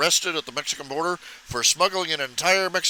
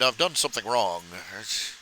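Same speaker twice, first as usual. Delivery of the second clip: usual speaking voice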